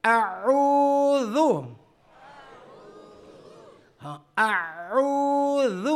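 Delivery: slow Quranic recitation of اعوذ, ع from the throat centre; drawn-out recitation. Shown as a demonstration. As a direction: stretch اعوذ out slowly like a tajweed teacher. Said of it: "میرے ساتھ پڑھیں".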